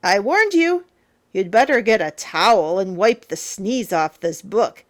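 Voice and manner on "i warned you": ordinary delivery, nothing marked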